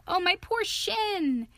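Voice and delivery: high, loud wail of self-pity; slow, high cry of pain. A narrator's reading. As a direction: wail over a hurt shin, drawn out. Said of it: "he cried".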